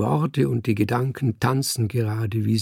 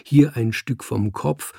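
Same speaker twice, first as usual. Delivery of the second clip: plain reading voice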